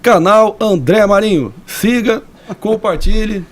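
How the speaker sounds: high-pitched mimicry of a politician; Jair Bolsonaro. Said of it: "ele fala". (imitação de Bolsonaro em tom mais agudo)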